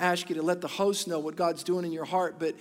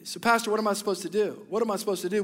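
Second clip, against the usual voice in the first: normal talking voice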